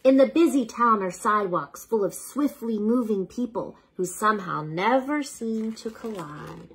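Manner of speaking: ordinary delivery, nothing marked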